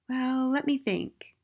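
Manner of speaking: put-on high, slow little-boy voice; high-pitched thoughtful schoolboy; small boy, pondering. A narrator's reading. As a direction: ponder it slowly as a child.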